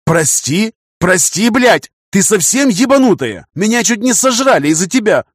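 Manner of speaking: loud, high furious outburst; angry yelling. Acted. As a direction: yell at her, furious.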